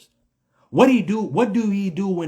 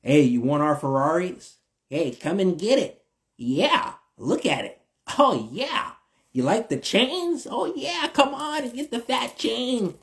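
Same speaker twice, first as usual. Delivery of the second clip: sly mocking dealer voice, rising higher; mock tempter; 5 semitones higher than usual. Speaker mocking the adversary offering chains, sly teasing. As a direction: dangle the bait like a sly tempter.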